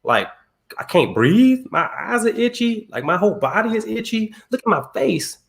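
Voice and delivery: imitating his own hurt whine; hurt complaint. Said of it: "my feelings were hurt 'cause I'm like".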